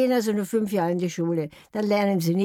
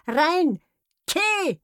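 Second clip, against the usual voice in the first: parrot mimicry; 8 semitones higher than usual. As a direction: squawk both halves like a parrot. Parrot and Heinz trade syllables.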